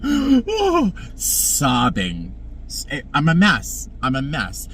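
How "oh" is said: sharp gasp, then a high, slow oh; retold shocked gasp into a high drawn-out oh. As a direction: gasp and let the oh rise high. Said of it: "I was like".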